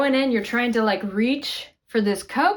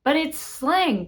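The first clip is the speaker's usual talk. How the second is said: ordinary talk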